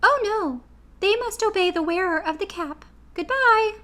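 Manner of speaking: Queen of the Mice to her friends, squeaky and kind; squeaky little voice. A narrator's reading.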